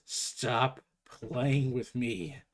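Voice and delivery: gruff hero voice. Acted. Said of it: "then Connor just says".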